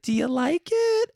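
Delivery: high, hopeful mom asking about the gift. Retold or imitated. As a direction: ask it high and slow like an eager mother.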